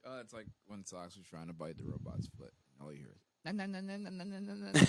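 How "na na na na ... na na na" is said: cat chomping noise. Retold or imitated. Imitating cat biting robot.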